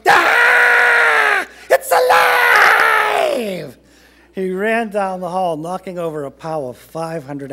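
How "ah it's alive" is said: very high, loud, drawn-out scream; terrified yell. A narrator's reading. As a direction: scream in panic, stretching the last word. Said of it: "The man yelled".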